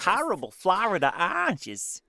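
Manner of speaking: high, exaggerated mystery accent; mock a strange regional accent on "Horrible Florida oranges"; weird mixed accent. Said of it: "I don't even know what that accent is anymore".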